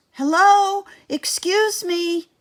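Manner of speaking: high, loud call across the water; shout. A narrator's reading. Kayla shouts to a fisherman, high and loud. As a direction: hail him from the boat. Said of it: "Kayla shouts to a fisherman".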